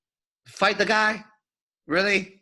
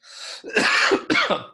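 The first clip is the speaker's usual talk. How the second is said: cough; 12 semitones higher, 3 dB louder than usual. Real, not acted. Coughing fit.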